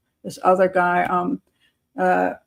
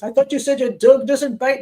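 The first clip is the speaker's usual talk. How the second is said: bitten man's complaint; 5 semitones higher, 5 dB louder than usual. Retold or imitated. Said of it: "and he goes".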